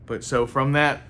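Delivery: ordinary delivery, nothing marked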